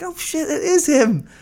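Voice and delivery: high, slow, gleeful "oh shit, it is him"; thrilled realization. Retold or imitated. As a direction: let the penny drop with delight.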